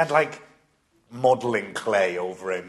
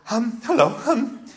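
nervous gushing; 8 semitones higher, 4 dB louder than usual. Retold or imitated. Nervous fan meets celebrity.

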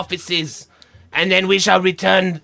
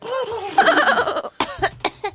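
high feeble cough; 3 dB quieter than usual. Acted. Falsetto cough before line.

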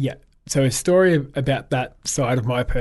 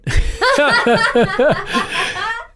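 bright burst of laughter; laughing; 6 dB louder than usual. Real, not acted. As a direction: break into a real laugh. Retelling a fan's praise.

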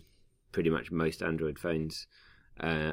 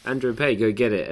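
excited announcement; 5 semitones higher, 6 dB louder than usual. Retold or imitated. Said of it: "they tweeted out saying".

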